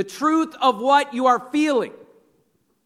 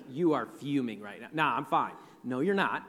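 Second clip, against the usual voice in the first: low, soft back-and-forth; muttered mock argument; 10 semitones lower, 10 dB quieter than usual. Preacher acts out partners disputing a mood, muttered mock argument. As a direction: play both sides quietly, as an aside. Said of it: "someone in a relationship with you has told you".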